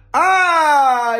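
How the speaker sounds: long, loud, high "aaah", sliding down; mock realisation "aah". Pretending the article explains everything, mock-enlightened drawn-out "aah". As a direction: draw out a hammed-up moment of revelation.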